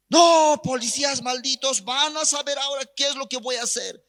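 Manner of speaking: high-pitched furious acted threat; enraged vow of revenge; threatening. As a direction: rail at the police, voice pushed high. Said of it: "Así amenazando".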